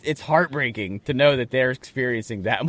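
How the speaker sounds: usual speaking voice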